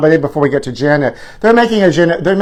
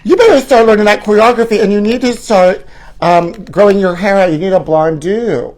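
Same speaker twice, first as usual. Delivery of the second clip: higher, louder bossy stage mother; pushy showbiz mother barking orders; 6 semitones higher, 3 dB louder than usual. Pretend. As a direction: push your little star to work harder.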